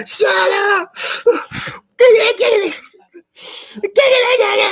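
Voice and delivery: very high, loud, shrieking commands; very high hammed-up furious screaming; screeching mock threats. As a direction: scream at the victim to shut up. Acted attacker again.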